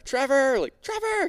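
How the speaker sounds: high calling shout. Retold or imitated. Diver calling to Trevor on the boat, shouting. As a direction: call across the water to a friend. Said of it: "So I gotta call Trevor".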